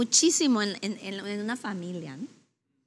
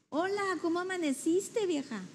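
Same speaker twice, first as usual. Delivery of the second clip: sweet greeting; 7 semitones higher than usual. Shown as a demonstration. Husband greeting his wife in the morning, sweet and tender. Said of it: "con un tono suave".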